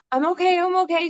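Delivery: insisting she's fine through pain; mock brave reassurance to her son; high, strained reassurance. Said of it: "I'm like".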